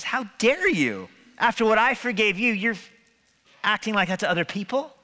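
indignant rebuke. Acted. Rich master rebukes forgiven servant.

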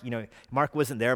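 ordinary talk

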